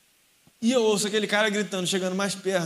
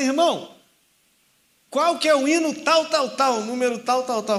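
higher and louder, pushy questioning; loud drunk quizzing; 6 semitones higher, 7 dB louder than usual. Retold or imitated. Drunk man testing the young believer on hymns, loud pushy questioning. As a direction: quiz him loudly, pressing for an answer.

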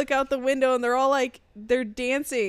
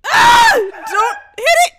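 high, loud shrieked protest; real panicked screaming; 14 semitones higher, 14 dB louder than usual. Real, not acted. Watching a partner miss the spider, panicked shouting.

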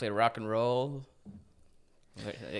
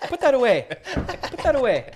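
high, loud scolding of a dog; frantic, raised-pitch telling-off, played for laughs; mock scolding; 10 dB louder than usual. Owner scolding his dog to put that away, mock scolding.

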